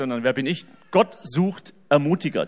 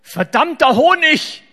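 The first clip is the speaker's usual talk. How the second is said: high, loud, slow mock curse of a cross child; kid's mock outraged swear; furious little boy; 11 semitones higher, 10 dB louder, slower than usual. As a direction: stamp it out like a furious six-year-old. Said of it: "wie er so wütend".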